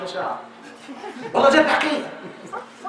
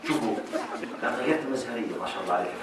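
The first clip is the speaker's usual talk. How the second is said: hushed teasing; 8 semitones lower, 5 dB quieter than usual. Retold or imitated. Playfully mocking husband and wife.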